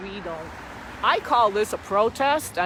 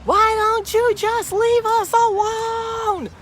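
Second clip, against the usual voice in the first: much higher, loud, slow shouted line; angry yell; 14 semitones higher, 8 dB louder, slower than usual. Retold or imitated. Vlogger imitating the red-faced driver's slow loud shout. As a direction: bellow it like the furious driver. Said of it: "So he yelled".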